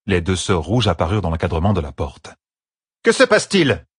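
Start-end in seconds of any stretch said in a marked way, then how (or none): 3.0-3.8 high, raised-voice question; alarmed, imperious question; sharp demand. Acted. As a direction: demand to know, pitch jumping up. Sister bursts in, demanding an explanation.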